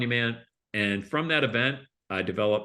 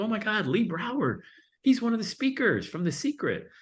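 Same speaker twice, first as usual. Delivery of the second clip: high, excited woman's voice; mock starstruck attendee, pitched up; lady reading brochure; 11 semitones higher than usual. Lady spotting a famous speaker, gushing high. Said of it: "She goes".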